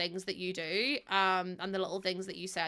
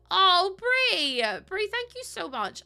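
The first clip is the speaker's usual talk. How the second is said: touched surprise; 9 semitones higher, 5 dB louder, slower than usual. Real, not acted. Touched by a chat message.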